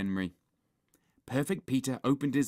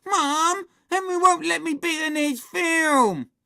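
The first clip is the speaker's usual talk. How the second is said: put-on high, loud tattling child's wail; complaining shout; 10 dB louder, slower than usual. Little brother tells on Henry to Mum, complaining shout. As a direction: wail for a parent's backing.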